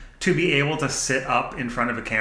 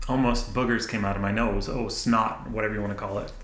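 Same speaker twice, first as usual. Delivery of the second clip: usual speaking voice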